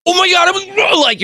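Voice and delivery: very high, mock-excited gabble; excited jumble of words. Host teasing the co-host's ball of words, high excited jumble. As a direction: imitate words tumbling out all at once. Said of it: "there's so many words all".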